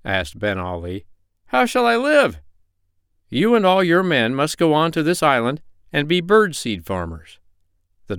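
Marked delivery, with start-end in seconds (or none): 1.5-2.4 high, loud pirate's protest; pleading pirate. A narrator's reading. 3.3-7.4 higher-pitched, lightly acted stern doctor. A narrator's reading. Doctor lays down terms.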